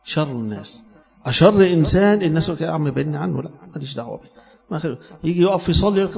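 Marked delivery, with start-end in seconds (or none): 2.6-4.3 dropping the voice, keeping away; wary aside. Retold or imitated. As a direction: say it under your breath, wanting no trouble. People avoiding the bully, quoted.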